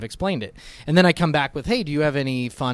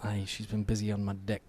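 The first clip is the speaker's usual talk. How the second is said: put-on Scottish accent; 6 dB quieter than usual. Scottish banter.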